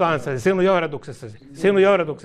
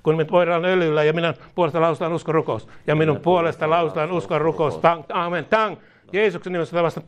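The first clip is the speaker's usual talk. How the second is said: ordinary talk, nothing marked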